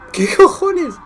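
a high, loud burst of disbelief; stunned outburst. Real, not acted. Fan baffled by a strange goal, yelling in high disbelief. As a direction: blurt it out, not believing what you just saw.

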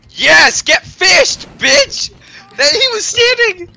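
screaming in triumph, high; triumphant shout. Real, not acted. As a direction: yell it out in victory. Gloating shout in game.

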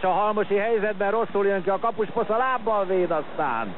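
high urgent live call; excited sports commentary. Real, not acted. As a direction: call the chance as it happens. Chance saved.

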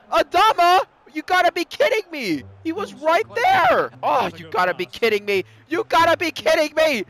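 ordinary talk

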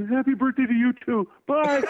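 imitated gleeful child; excited kid. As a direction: blurt it like a thrilled little fan. Retold excited child signing off.

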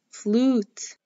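Flute with a drawn-out "oo"; long U sound. Shown as a demonstration. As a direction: stretch the vowel in Flute. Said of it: "flute has the long U sound".

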